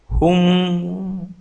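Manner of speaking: long, held hum; drawn-out listening hum; child's story response. Acted. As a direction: hum along like a child following a bedtime story. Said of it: "ఊ ఊ ఊ అన్న".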